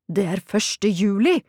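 drawn-out, understated wail of exasperation; near-desperate cry. A narrator's reading. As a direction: cry it out slowly, almost despairing. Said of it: "skrek han, nærmest fortvilt".

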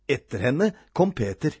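ordinary delivery

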